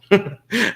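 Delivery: sudden loud high laugh; burst of laughter. Real, not acted. Amused by the show host, a loud high burst of laughter. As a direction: break into a real high laugh.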